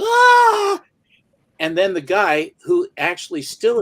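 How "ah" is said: very high, loud movie scream of pain; mock scream of the blinded hero; agonized scream. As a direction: let out a hammed-up howl of agony. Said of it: "So Tyrone Power's going".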